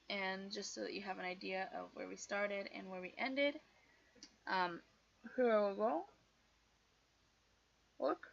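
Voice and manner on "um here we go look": talking around a pen held in the mouth; muffled words past a pen. Real, not acted. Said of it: "I put the pen in my mouth".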